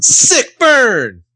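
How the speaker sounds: high, crowing mock triumph; triumphant taunt. Cheering an imagined put-down of Lucas, mock triumphant. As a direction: crow it like a winning comeback.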